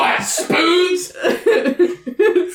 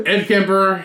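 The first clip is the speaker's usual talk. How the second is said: ordinary talk, nothing marked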